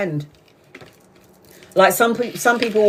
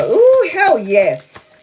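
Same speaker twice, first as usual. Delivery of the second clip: drawn-out, delighted cry, higher and louder; excited; 7 semitones higher, 5 dB louder, much slower than usual. Real, not acted. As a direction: let the cards thrill you. Excited reaction to the cards.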